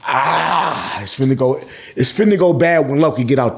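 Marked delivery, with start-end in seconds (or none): none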